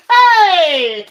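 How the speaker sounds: loud, high outburst; excited shout. Real, not acted. Streamer reacting to a viewer's pick, loud shout. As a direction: shout it out in surprise.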